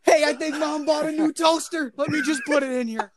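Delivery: high shout across the house; yelling to another room. Retold or imitated. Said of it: "yelling at his brother".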